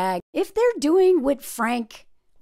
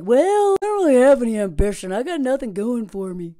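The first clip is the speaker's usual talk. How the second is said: mock dopey guy; 4 dB louder than usual. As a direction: parody a loser boyfriend. Mocking Leo's reply.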